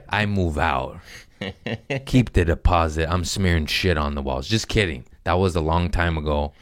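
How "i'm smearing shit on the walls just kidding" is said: usual speaking voice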